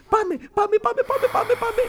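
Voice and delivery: high, excited call to hurry; childlike excited hurry; eager urging. Acted. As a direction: rush them along, giddy. Urging friend to the wedding.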